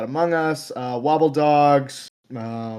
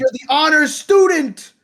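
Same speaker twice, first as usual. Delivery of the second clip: high, loud, slow hammed-up jeer; mock shout; 13 semitones higher, 5 dB louder than usual. Guest jeers at the co-host's honors-student gag, high loud shout. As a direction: drag it out in mock accusation.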